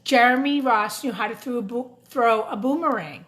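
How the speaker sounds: put-on lively storybook narration for kids; a children's book read aloud. A narrator's reading. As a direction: read it like story time in class. Reads picture book to children.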